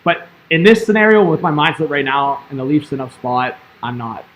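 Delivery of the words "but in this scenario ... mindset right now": ordinary talk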